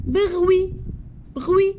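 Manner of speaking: bruit said loud and high, the T left silent; modelled loudly. Shown as a demonstration. Teacher calls out the word for the class, loud and high. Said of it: "oui, this one".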